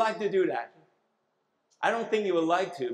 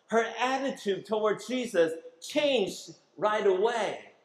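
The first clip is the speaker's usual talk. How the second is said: ordinary talk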